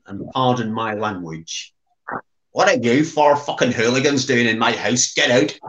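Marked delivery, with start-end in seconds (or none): 2.5-5.6 mock-indignant broad Northern Irish accent; broadest Northern Irish accent. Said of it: "says in his broadest accent".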